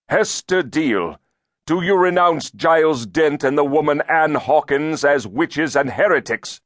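high, loud formal demand; stern inquisitor. A narrator's reading. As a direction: press the witness like a zealous judge. Elder demands renunciation of accused.

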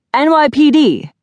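high, loud police shout. A narrator's reading. Policeman announces himself to hotel guests, shouting. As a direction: announce yourself forcefully through a closed door. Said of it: "a male voice shouted".

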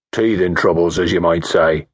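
low, hoarse country mechanic; a rough-voiced village mechanic, unbothered. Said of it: "in a low, hoarse country voice".

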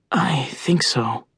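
hushed, breathy reply; whispering. Acted. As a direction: whisper so no one below hears. Hero answers quietly on rooftop.

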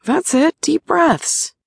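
high, gentle coaxing; lightly voiced encouraging partner. A narrator's reading. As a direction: coax her to breathe. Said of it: "Shane encouraged".

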